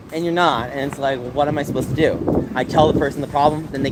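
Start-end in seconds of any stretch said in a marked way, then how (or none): none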